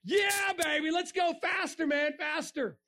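high, thrilled rider yelling; loving it. Acted. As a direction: whoop it up like a thrill-seeker. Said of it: "you're gonna be loving it".

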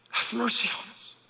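breathy whispered plea. Retold or imitated. Leper begging Jesus for mercy, hushed whisper. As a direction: beg it under your breath.

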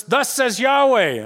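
slow, loud, high proclamation; proclaiming. Retold or imitated. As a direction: announce it like a herald, slow and loud. Contest of proclamations, Moses side.

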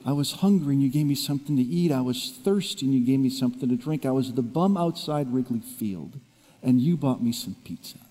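soft, hushed voice of God; a hushed divine voice the speaker hears on the bus; voice of God, quietly. Retold or imitated. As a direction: speak God's words softly, as if remembered. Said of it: "as if I heard God's voice".

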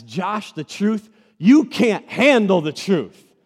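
slow, loud you can't handle the truth; movie quote impression. Pastor quoting A Few Good Men to Josh, slow and loud. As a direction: bark it like a courtroom showdown. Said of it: "What movie did I just insert?".

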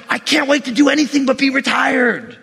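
high, exclaimed mock longing; mock impatience. Impatient retiree voiced in a sermon.

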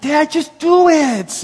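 high, loud yell, stretched out; impatient kid pushing dad to get on with it; son's exasperated yell. Retold or imitated. Son to his tearful dad mid-baptism, exasperated yell.

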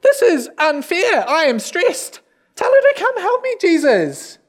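high-pitched, put-on whine of grievance; upset, stressed Martha. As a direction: complain loudly up high, dragging the words. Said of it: "She's probably upset".

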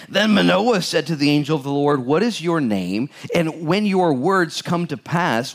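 scripture reading. Acted. Pastor rereading the angel's reply, emphatic reading voice. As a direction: read it out for all to hear. Rereads verse seventeen and eighteen.

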